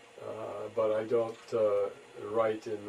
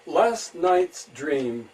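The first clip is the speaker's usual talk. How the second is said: haiku reading; 3 semitones higher than usual. Acted. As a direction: let each phrase hang, then drop to a hush. Reads own haiku.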